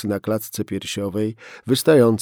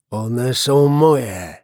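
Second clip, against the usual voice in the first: very slow, stretched, stress on "są"; through clenched teeth; 2 semitones higher, 6 dB louder, much slower than usual. Acted. Gunman claiming his children to the policeman, through clenched teeth. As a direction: grind each word out, menacing. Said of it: "wycedził mężczyzna".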